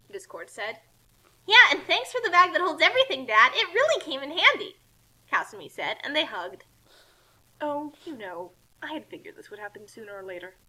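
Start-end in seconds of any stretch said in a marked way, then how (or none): 1.5-4.8 Kasumi voice. Acted. Kasumi thanking dad. 7.6-10.6 Discord voice. Acted. Discord voice unlike speaker.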